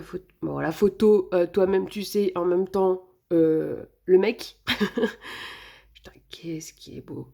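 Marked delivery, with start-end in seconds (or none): 5.9-7.3 hushed, breath-only admiration; very soft, smitten whisper; awed whisper. Real, not acted. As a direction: swear softly under your breath in awe. Awed by the singer's photo.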